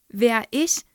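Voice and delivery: put-on girl taken aback when accused; surprised question. A narrator's reading. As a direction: caught off guard and innocent. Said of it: "fragte Mathilda".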